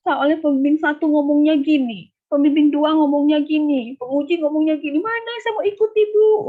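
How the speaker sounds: high, loud, rising to a near shriek; a mild mock of the student's hysterical outburst. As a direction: imitate a frantic student at her wits' end. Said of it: "teriak-teriak histeris".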